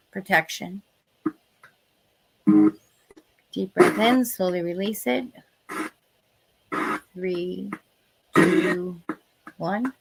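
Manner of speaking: calm, slow meditation guidance; guided meditation. Acted. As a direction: guide them gently into protection. Leads a protection meditation.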